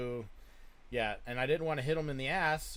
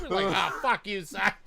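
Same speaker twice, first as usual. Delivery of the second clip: laughing dad; 7 semitones higher, 8 dB louder, slower than usual. Retold or imitated.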